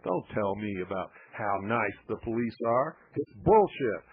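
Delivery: ordinary delivery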